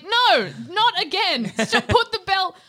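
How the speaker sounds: whiny plea to hand it back; exasperated townsfolk. Retold or imitated. Voicing annoyed townsfolk.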